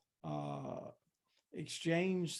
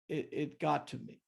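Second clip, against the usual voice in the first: ordinary talk, nothing marked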